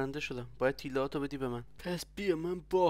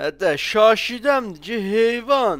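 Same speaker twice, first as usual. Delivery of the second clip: slow yell; 6 semitones higher, 13 dB louder, much slower than usual. Acted. Held at gunpoint, yelling back.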